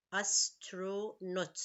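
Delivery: Astronaut drawn out slowly, syllable by syllable; split into syllables. Shown as a demonstration. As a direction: count the syllables of Astronaut as you say it. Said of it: "Three syllable".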